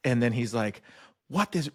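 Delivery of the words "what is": high-pitched mock outburst. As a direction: start an imitated angry rant. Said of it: "he was letting it out".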